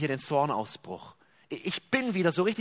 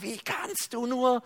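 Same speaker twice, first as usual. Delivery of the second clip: mock shout; 6 semitones higher, slower than usual. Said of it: "Und er schreit".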